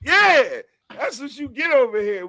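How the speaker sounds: excited, high-pitched hollering; hyped cheering. Real, not acted. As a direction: celebrate the verse up high. Hypes the rapper after verse.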